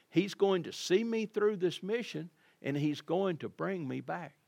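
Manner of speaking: ordinary delivery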